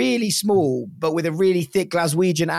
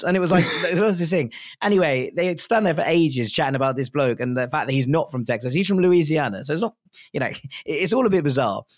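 ordinary delivery

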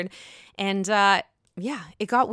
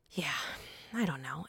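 breathy whispered aside; under-the-breath shrug; 6 semitones lower, 14 dB quieter than usual. Real, not acted. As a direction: drop to a whisper. Trailing aside after ranting.

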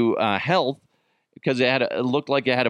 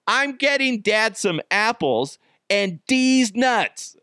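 sweet daughter voice; 9 semitones higher, slower than usual. Retold or imitated. Daughter proudly tells mom.

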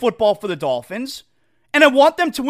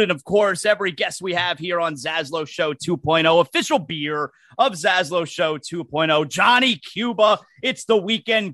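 beer ad read; 5 semitones lower than usual. Acted. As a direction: roll through the ad copy. Live sponsor read.

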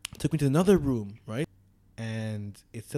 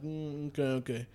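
soft, barely bothered murmur; lazy mumble; 9 dB quieter than usual. Retold or imitated. Imitating the lazy cop sitting in the back, listless. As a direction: imitate a bored, idle cop. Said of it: "the guy in the back is just lazy".

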